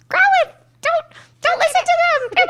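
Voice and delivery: very high, loud pleading voice; high Officer Jenny. Retold or imitated. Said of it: "Officer Jenny's like".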